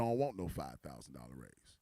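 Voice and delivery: very low, soft refusal; low-voiced man, not interested; unimpressed employee. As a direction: drop deep and turn it down. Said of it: "He said".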